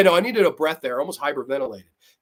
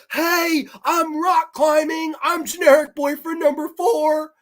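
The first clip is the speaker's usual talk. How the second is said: mock dumb boyfriend; 10 semitones higher, 4 dB louder, slower than usual. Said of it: "he's like".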